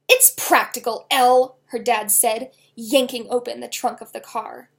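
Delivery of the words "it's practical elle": loud defensive dad; loud retort. A narrator's reading. Dad shouting back at his daughter, defensive. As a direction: bark it back at her.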